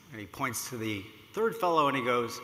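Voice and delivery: ordinary talk, nothing marked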